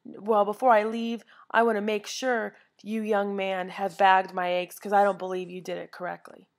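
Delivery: loud, scolding demand; indignant customer. Retold or imitated. Impatient woman telling the bagger off, loud. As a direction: insist like an offended shopper. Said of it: "And she said".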